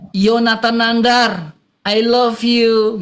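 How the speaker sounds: very slow, deliberate model line; slow dictation. Shown as a demonstration. Brother slowly modelling the line again. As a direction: lay it out word by word. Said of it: "Bilang aja".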